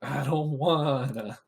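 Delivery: low, soft whiny mock groan; reluctant demon. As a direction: groan it like a sulking freeloader. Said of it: "He's gonna be like".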